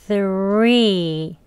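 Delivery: three stretched out with a clear 으 after th; slow three. Shown as a demonstration. Teacher showing slow three to learners. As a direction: draw three out slowly. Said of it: "천천히 발음하시면".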